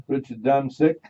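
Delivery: usual speaking voice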